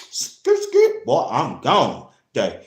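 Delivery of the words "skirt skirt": very high squealing skirt skirt; tire screech noise. Retold or imitated. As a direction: squeal it like tires peeling out. Imitating tires screeching off.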